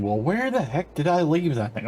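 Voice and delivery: high, puzzled mock exasperation; exasperated wondering. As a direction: act baffled about where it went. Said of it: "three episodes thinking".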